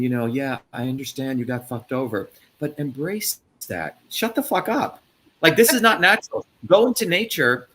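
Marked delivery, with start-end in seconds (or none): 4.1-7.7 loud, high furious outburst; shouting shut the fuck up, then pushing on; angry shout. Real, not acted. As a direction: yell it at them, fed up.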